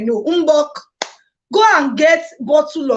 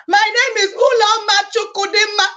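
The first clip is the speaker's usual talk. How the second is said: proud laughing; 7 semitones higher than usual. Real, not acted. Proudly says her own long name.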